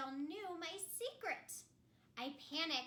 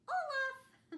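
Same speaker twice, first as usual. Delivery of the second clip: high, loud laughing name; bright goofy reveal; cheerful snowman; 12 semitones higher, 7 dB louder than usual. Acted. Storyteller introducing the snowman, laughing.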